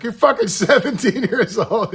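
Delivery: high, loud imitated shock, breaking into laughter; shocked disbelief. Singer learning the tall kid's age, shocked. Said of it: "He was shocked".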